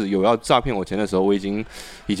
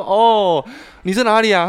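pleased; 11 semitones higher, 6 dB louder, slower than usual. Retold or imitated. Her own retold voice on call.

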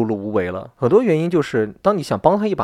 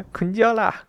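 laughing; 9 semitones higher, 4 dB quieter, slower than usual. Real, not acted. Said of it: "跟我们山东话一样哎".

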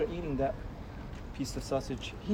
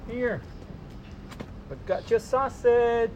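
higher, slow coaxing sing-song; 7 semitones higher, slower than usual. Real, not acted. Father luring a shy cat with sausage, coaxing. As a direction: coax the shy cat out gently.

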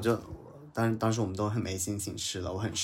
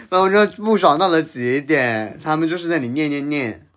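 heated outburst; 4 semitones higher, 9 dB louder than usual. Real, not acted. Fury peaks in the retelling.

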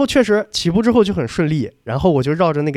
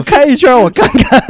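coach's order repeated much higher and louder through laughter; laughing mock coach; 9 semitones higher, 9 dB louder than usual. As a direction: quote him again, cracking up. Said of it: "他说". (边笑边高声学教练说话)

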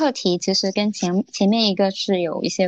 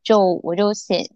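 ordinary talk

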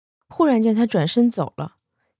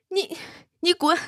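angry dismissal; 10 semitones higher than usual. Acted. Xiaoqing chases Xu Xian out.

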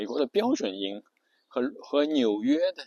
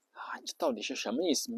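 困惑低语；压低气声，自言自语般困惑；像听不懂的人小声犯嘀咕；困惑的耳语; 7 dB quieter than usual. Retold or imitated. 学听者困惑地嘀咕.

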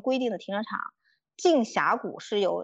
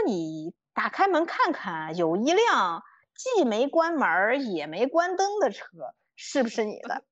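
slow, drawn-out uncle impression; stretched-out words of a stranger at the door; middle-aged man's voice; slower than usual. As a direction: play an older guy spelling it out slowly. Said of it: "是一个大叔的声音".

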